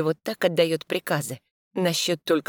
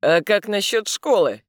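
pointed, louder challenge; challenging; 5 semitones higher, 5 dB louder than usual. Acted. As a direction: throw it down as a challenge. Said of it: "с вызовом спрашивает Михаил".

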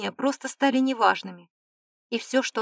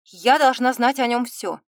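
firm, louder insistence; stern; 2 semitones higher, 7 dB louder than usual. A narrator's reading. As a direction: insist on it strictly. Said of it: "строго выдала девушка". (строго и настойчиво)

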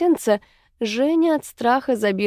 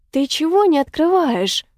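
higher-pitched pushy demand; having a go; 6 semitones higher, 4 dB louder than usual. A narrator's reading. Zhenya nags her friend about not opening up, having a go. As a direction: lay into a friend with an indignant question. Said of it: "начала наезжать на неё Женя".

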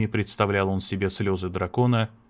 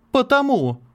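much higher, louder snapped retort; hateful shout; 5 dB louder than usual. Acted. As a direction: spit it out with hatred. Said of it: "с ненавистью во взгляде крикнула она". (крикнуть с ненавистью)

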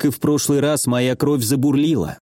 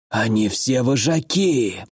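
slow, hoarse and poisoned; hoarse rasp; slower than usual. A narrator's reading. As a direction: rasp it out, dying from venom. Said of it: "прохрипел лидер отряда".

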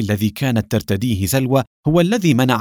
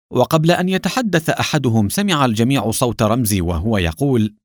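plain reading voice

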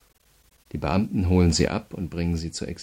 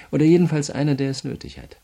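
much higher and louder, sneering at a desperate lawyer; scornful jab; 9 semitones higher, 8 dB louder, faster than usual. Acted. Prosecutor mocking the prisoner's hopes, high scornful sneer. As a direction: spit out the contempt in a high burst.

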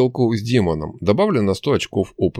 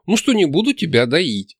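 higher, exasperated exclamation; exclaiming; 8 semitones higher than usual. A narrator's reading. Nastya repeating her offer to the hero, exclaiming. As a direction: spell it out with exasperation. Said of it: "воскликнула Настя".

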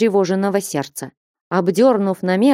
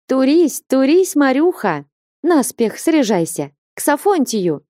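lively, higher-pitched urging; excited calling; 7 semitones higher than usual. A narrator's reading. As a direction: hurry a friend along gleefully, jumping about. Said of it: "кричала Флёнушка".

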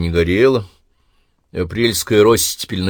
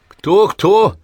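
surprised; 12 semitones higher, 6 dB louder than usual. Acted. Skull doesn't recognize visitor.

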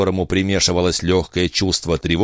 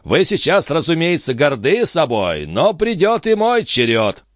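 high, slowing taunt; taunting call; 9 semitones higher than usual. A narrator's reading. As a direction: threaten a comeback in a high voice. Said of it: "крикнул ему Жан".

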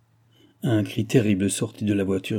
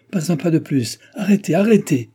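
much higher and louder, a desperate plea to stop; frantic shout; 8 semitones higher, 5 dB louder than usual. A narrator's reading. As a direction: scream at the driver to halt the horses. Woman shouting for the coach to stop.

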